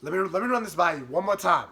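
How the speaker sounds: ordinary talk, nothing marked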